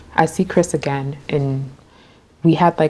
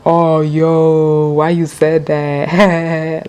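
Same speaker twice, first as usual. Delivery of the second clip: mock laughing; 5 dB louder, much slower than usual. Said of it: "like, laughing".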